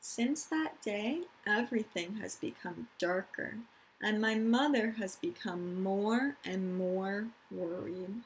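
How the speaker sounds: calm storytime reading; reading to children. Acted. Teacher reading a picture book to her class, gentle storytime.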